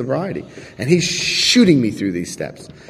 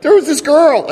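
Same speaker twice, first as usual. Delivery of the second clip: much higher, laughing outburst; blurted confession; slower than usual. Retold or imitated. Confessing to his sponsor, a high giddy blurt.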